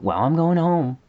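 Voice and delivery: high, loud, breezy announcement; worker clocking out. Retold or imitated. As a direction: sing out that you're done for the day. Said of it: "she's immediately like".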